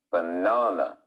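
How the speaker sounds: "Banana" with a long, strong middle syllable; stress on second syllable. Shown as a demonstration. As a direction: stretch the second syllable of "Banana" and drop away after it. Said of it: "It's on the second syllable".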